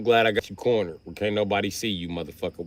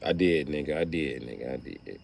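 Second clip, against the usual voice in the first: sheepish admission; 8 semitones lower, 7 dB quieter than usual. Retold or imitated. Thief admits sheepishly.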